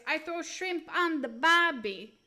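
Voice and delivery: loud, hammed-up Australian accent; shaky stab at Australian. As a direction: put on a mock Aussie accent. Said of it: "mix up my Australian with my British".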